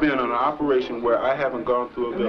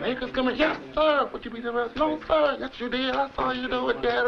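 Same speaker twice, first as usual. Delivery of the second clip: drill interrogation; 11 semitones higher than usual. Retold or imitated. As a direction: play both sides of a drill grilling. Sergeant interrogates recruit voiced.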